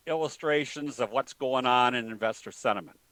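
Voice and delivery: ordinary delivery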